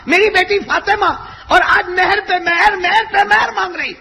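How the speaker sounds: tearful, shouted high climax; preaching at full force. Acted. Preacher cries out Gabriel's message, weeping and shouting. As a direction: wail it out to the crowd.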